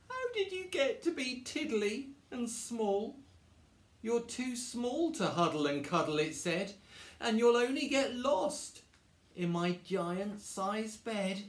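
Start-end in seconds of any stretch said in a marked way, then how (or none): 0.1-6.2 high-pitched, plaintive bear wail; bawling. Acted. Bear bawls at a tiny teddy, plaintive. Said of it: "hear the voice bawl". 7.2-11.4 high, plaintive complaint, slowing at the end; bawling. Acted. Bear bawls about its giant-sized bed, plaintive. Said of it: "hear the voice bawl".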